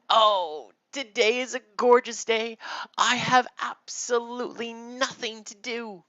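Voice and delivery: high, loud, theatrical contentment; carefree sister. Acted. Sarah stretches out her arms, loudly carefree. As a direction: stretch luxuriously through a high, loud exclamation.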